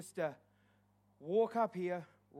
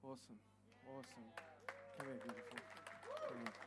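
much softer, close murmured words of welcome; murmured encouragement; 9 semitones lower, 19 dB quieter, much faster than usual. Real, not acted. Pastor quietly welcoming those who come forward, murmuring. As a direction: gently welcome each person at the altar.

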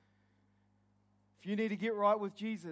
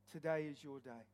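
soft plea; 7 semitones lower, 8 dB quieter than usual. Real, not acted. Altar call, softly repeated.

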